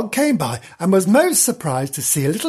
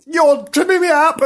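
high, flustered yelp; high-pitched indignant cry; 12 semitones higher, 4 dB louder than usual. A narrator's reading. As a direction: cry out as you stumble. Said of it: "cried Nobs".

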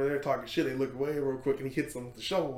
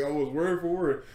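ordinary delivery

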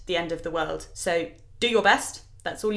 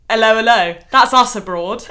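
loud hammed-up tourist greeting, much louder; mock Brits abroad; 3 semitones higher, 11 dB louder than usual. Pandora mocking herself and Dolly on holiday, loud hammed-up tourist voice. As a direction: shout it like clueless Brits abroad. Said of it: "Dolly and I are like".

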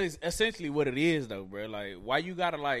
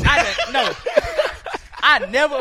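loud, higher denial through laughter; laughing protest; 9 semitones higher, 9 dB louder than usual. Real, not acted. Accused co-host denies ever agreeing, loud and laughing. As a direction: protest loudly while cracking up.

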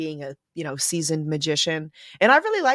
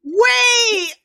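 high, loud startled shout; shocked shout; 11 dB louder than usual. Real, not acted. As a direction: cut in with a shocked wait. Sudden outraged interruption.

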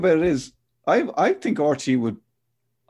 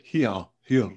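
put-on self-admittedly poor Welsh accent on "here", said lower; 3 semitones lower than usual. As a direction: try the Welsh "here" and miss it. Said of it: "I can't do a Welsh accent".

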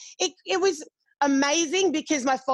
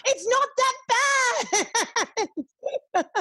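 laughing protest; 11 semitones higher, much slower than usual. Retold or imitated. Refusing a GoFundMe for her, high laughing protest. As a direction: squeal the no, then laugh through a drawn-out denial. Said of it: "And I was like".

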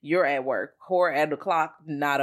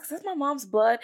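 high mock daughter; 10 semitones higher than usual. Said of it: "she's like".

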